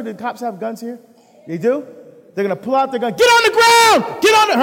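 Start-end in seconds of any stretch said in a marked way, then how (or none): 3.1-4.6 high, loud yelled police command; police shout. Pretend. Police officer yelling at man on the street, full shout. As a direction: scream it like a cop with gun drawn.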